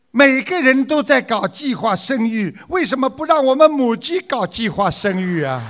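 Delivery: high-pitched mock complaint; grumbling hen. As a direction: play an aggrieved hen protesting. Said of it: "母鸡发牢骚了".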